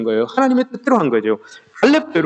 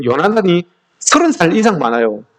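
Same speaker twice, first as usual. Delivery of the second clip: normal talking voice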